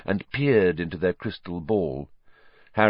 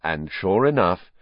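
usual speaking voice

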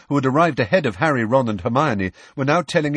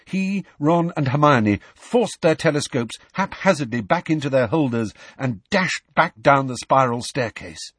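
plain reading voice

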